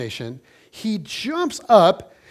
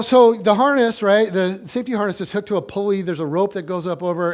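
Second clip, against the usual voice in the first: ordinary delivery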